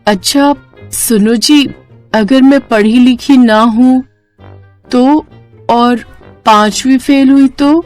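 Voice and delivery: nervous hesitation. Acted. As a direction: feel your way timidly towards a confession. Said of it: "वो घबरा गई और हिचकिचाते हुए बोली". (घबराकर हिचकिचाते हुए)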